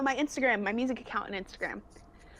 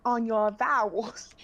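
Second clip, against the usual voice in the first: loud, drawn-out vowels as a choir teacher; imitating choir teacher; 5 dB louder, slower than usual. Choir teacher coaching a singer on vowels, imitated. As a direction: open the vowels wide, slow and exaggerated. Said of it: "she would say".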